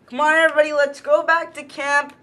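ordinary delivery

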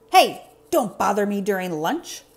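loud grouch voice; grouchy grumble. Acted. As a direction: grumble at whoever interrupted lunch. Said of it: "a grouchy voice grumbled".

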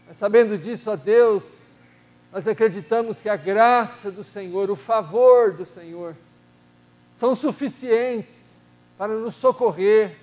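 usual speaking voice

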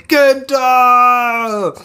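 high, loud, drawn-out shout; scream of a name. Acted. The scream of the fallen hero's name, high anguished shout. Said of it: "not the scream of the name".

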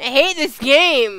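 loud, high, slow complaint; frustrated outburst. Real, not acted. Frustrated player complaining about the game, exasperated. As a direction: drag it out loudly in frustration.

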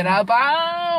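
drawn-out, high, loud jeer; mock jeering. Jeering at a friend about how they talk, mock jeer. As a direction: stretch it out slowly in a mocking shout. Mimicking rough way of talking.

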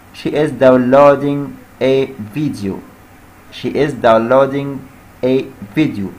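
words stretched slowly; slow dictation. Shown as a demonstration. Teacher dictating the answer slowly to students. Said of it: "نبدأ ب she is".

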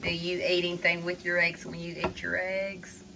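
normal talking voice

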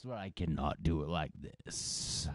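ordinary delivery

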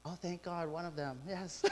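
normal talking voice

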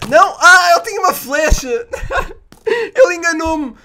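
high, loud laughing yell; loud high-pitched gamer wail with a laugh; shocked outcry. Real, not acted. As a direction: shout in amused outrage at being tricked. Killed by a hidden arrow.

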